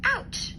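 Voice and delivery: put-on girl burnt by hot porridge; sharp ouch. A narrator's reading. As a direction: yelp like you burnt your tongue. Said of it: "she said".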